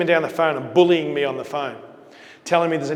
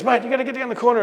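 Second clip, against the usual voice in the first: urgent call; 9 semitones higher, 4 dB louder, faster than usual. Retold or imitated. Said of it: "he goes".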